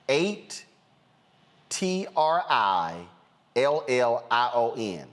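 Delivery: letters dragged out with long pauses; slow spelling out. Shown as a demonstration. Host spelling out the figure for folks, slow and emphatic. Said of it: "let me say this slowly".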